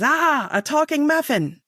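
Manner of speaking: punchline of a kids' joke, mock alarm; startled muffin. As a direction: play the shocked second muffin, drawn out. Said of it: "the other muffin looks back and says".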